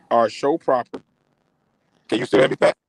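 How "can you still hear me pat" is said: breaking up on the call. Real, not acted. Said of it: "you kinda broke up a little bit".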